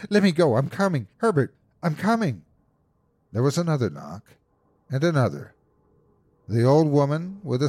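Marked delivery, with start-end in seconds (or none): 0.1-2.4 high, urgent calling out; struggling cry. A narrator's reading. Mother calls to her son while struggling, frantic cry. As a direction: call to someone outside while pulling free. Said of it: "she cried, struggling".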